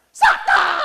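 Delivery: sudden piercing cat screech. Acted. Cat lunges for the skillet, screeched cry. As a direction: explode into a very high, loud yowl.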